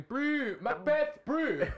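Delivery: high-pitched South African "brew" calls; South African bro. Acted. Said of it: "like, South African accents and things".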